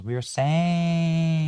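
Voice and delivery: slow mocking parody of the speaker; mock AOC voice. Shown as a demonstration. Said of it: "Say it in the wrong burgundy voice".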